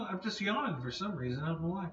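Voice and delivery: normal talking voice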